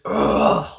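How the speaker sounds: drawn-out disgusted "ugh"; frustrated groan. Retold or imitated. Man finding hair all over himself, frustrated groan. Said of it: "I let out this, like, just frustration, just not loud".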